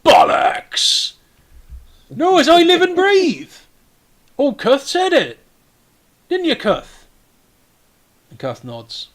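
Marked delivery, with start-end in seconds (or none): 0.1-7.1 loud disbelief jumping up high; scruffy dwarf exclaiming in amazement; Escobert the Red. Acted. Castellan hears the hero is Tom Bass, loud astonishment. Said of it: "this is Escobert the Red".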